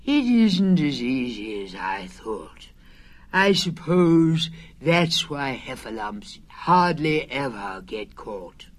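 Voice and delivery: slow, low, mildly sad bear; sadly. Acted. Pooh sadly admitting the trap is hard. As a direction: sigh it out, a touch of gloom. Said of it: "then added sadly".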